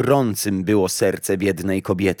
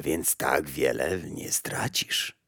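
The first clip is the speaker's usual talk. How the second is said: put-on hushed, slow breathy old woman; secretive whisper; 5 semitones lower, 8 dB quieter, slower than usual. Old woman bargaining slyly.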